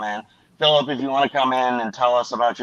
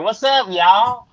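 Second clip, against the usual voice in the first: mocking; 10 semitones higher, 4 dB louder, much slower than usual. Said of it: "I hate when people are like".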